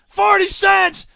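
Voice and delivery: a cartoon sister shouting her bid, loud and high; Patty yelling. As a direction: shriek out the bid across the room. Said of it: "Patty goes".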